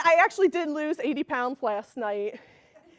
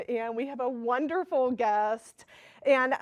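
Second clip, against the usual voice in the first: usual speaking voice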